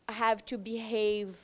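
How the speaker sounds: ordinary delivery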